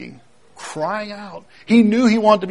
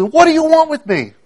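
much higher-pitched, mildly raised cry; retold shout; 11 semitones higher, 3 dB louder than usual. Possessed man runs down to Jesus, retold shout. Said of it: "He shouted out at the top of his voice".

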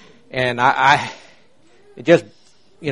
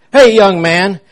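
loud, high call out the car window; hollering at the young man; yelled; 7 semitones higher, 7 dB louder, slower than usual. Retold or imitated. Woman in Cadillac yells at young driver, loud high call. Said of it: "she yelled out her window".

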